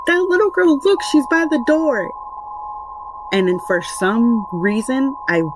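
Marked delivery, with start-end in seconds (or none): 0.1-2.1 put-on high little-girl voice pointing something out; imitating a small child. Daughter telling parents about the girl, high child voice. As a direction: voice the daughter insisting, pitched up high. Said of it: "And she goes".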